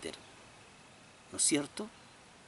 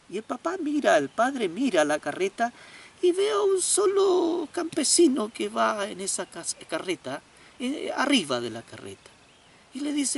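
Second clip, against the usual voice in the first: normal talking voice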